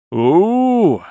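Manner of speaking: long high, loud "ooh"; drawn-out wail of dread; alarmed hoot. A narrator's reading. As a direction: let out a long rising-falling ooh. Runaway reacts to the threat.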